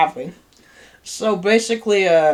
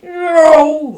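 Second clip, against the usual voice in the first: imitated train horn; 10 semitones higher, 10 dB louder than usual. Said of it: "the train went like".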